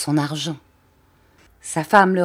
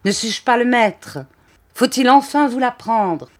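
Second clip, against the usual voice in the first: higher, loud menacing shout; furious threat; 6 semitones higher, 5 dB louder than usual. A narrator's reading. The count threatening his wife, shouting higher and louder. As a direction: bear down on her.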